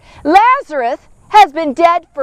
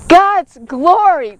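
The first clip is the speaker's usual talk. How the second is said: ordinary talk, nothing marked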